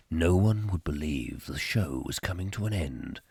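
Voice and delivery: low, ominous album narrator; dramatic narrator. Acted. Host warns the show is ending, in deep narrator voice. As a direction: announce it like impending doom. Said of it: "I love doing that".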